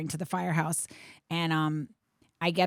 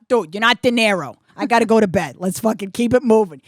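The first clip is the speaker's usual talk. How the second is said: loud, exasperated griping; acted impatient husband, louder; imitated complaining; 4 semitones higher, 10 dB louder than usual. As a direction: push it out like you're fed up and want bed. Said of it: "he's complaining".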